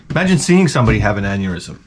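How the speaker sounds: normal talking voice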